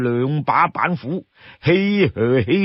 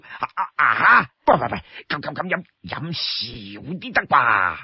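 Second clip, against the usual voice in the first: flustered bargaining; 3 dB quieter than usual. Acted. Li Kui balks at drinking ban.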